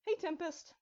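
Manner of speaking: high greeting called across the room; calling out. A narrator's reading. Starlight calling to the arriving couple. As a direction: call out to friends arriving. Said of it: "stood to call to them".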